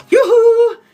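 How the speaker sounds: loud high yoo-hoo holler; cowgirl holler. Pretend. Pretend Texan cowgirl yelling across the ranch, loud and high.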